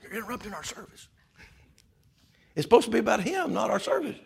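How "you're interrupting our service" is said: very soft hushed complaint; disapproving shush. Acted. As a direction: shush them, then object under your breath. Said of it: "the world says".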